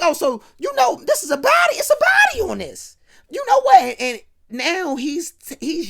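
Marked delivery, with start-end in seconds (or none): 0.0-3.9 yelled, far higher outburst; furious shout. Retold or imitated. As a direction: explode at him in rage. JC explodes at G.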